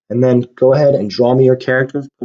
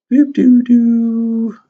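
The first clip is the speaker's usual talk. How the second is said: high, loud doop-doo-doo; playful doodling noises; 9 semitones higher, 3 dB louder, much slower than usual. Pretend. Teacher draws a bunch of people, playful doodling noises. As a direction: toot out high sounds as you sketch. Singsong noises while drawing stick figures.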